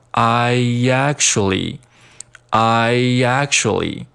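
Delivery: "I actually" joined with a y glide, "I-yactually"; linked words. Shown as a demonstration. Teacher drilling liaison, "I actually" linked with a y glide. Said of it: "I和actually进行连读".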